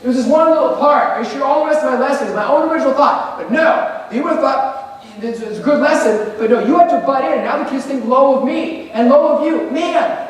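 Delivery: high, pleading, self-pitying; whiny plagiarist excuses. Retold or imitated. Teacher imitating a student caught plagiarizing, high whiny excuses. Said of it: "I say the very things kids say".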